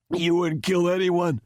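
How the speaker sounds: high-pitched, strained croaking; high hoarse voice of a throttled man. A narrator's reading. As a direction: croak it out as if just choked. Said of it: "Chastain croaked hoarsely".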